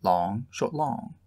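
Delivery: long short syllables chanted with rising tune; scanning the pattern aloud; rhythm recited. Shown as a demonstration. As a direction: chant the metre, leaning on each long. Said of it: "The critic".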